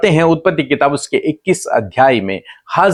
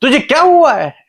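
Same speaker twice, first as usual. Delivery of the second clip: higher-pitched, questioning divine voice; voice of God; 14 semitones higher, 3 dB louder than usual. Retold or imitated. God asks weeping Hagar why she cries, raised questioning voice. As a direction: ask like God gently probing her tears, pitched up. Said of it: "परमेश्वर पहली बात उससे कहते हैं कि".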